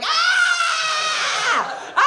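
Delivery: high piercing mock scream, two bursts; comic scream. Comedian reenacts screaming at the table of women, high mock shriek. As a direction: shriek it out like a horrified date. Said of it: "I wasn't just screaming at him".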